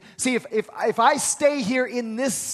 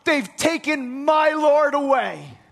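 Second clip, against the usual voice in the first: higher, louder indignant protest; 6 semitones higher, 4 dB louder, slower than usual. Retold or imitated. Mary to the angels at the tomb, indignant and distraught. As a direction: plead it, beside herself. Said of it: "she gets indignant with angels".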